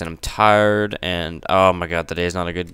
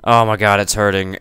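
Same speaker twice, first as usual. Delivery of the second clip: ordinary delivery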